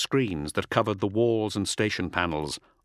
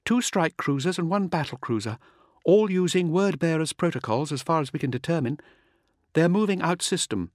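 higher-pitched bridge officer reporting; pitched-up voice of an officer giving a sitrep; officer's report; 7 semitones higher than usual. Acted. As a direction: report enemy ships in a raised, businesslike voice. Voices officer reporting ship movements.

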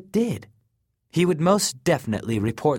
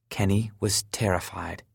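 ordinary delivery, nothing marked

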